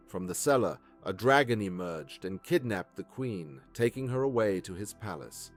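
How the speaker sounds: ordinary delivery, nothing marked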